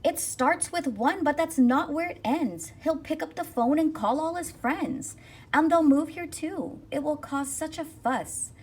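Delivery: high, loud, alarmed townsfolk shout; angry crowd yelling that the newcomers will take over. Acted. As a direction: rouse the crowd with a loud panicked warning. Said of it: "shouted aloud".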